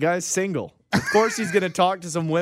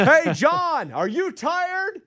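mock question; 10 semitones higher, much slower than usual. Mock asking a worker.

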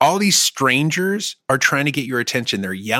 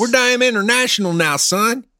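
drawling yokel; 11 semitones higher, 4 dB louder than usual. Acted. Put-on yokel voice.

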